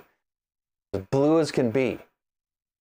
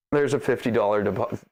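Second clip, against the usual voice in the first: normal talking voice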